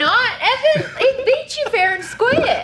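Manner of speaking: high, loud laughing gloat; laughing taunt. Pretend. Teasing gloat at Evan.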